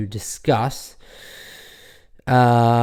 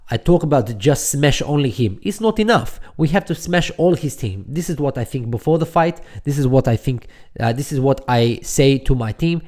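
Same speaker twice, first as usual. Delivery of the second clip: put-on loud, forceful Khabib in a Dagestani accent; 5 dB louder than usual. Podcaster imitating Khabib's scariness for listeners, Dagestani accent. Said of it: "I'll try and do my best Dagestani accent here".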